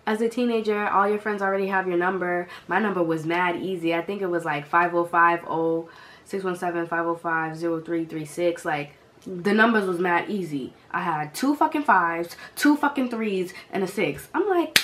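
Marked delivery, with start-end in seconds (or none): none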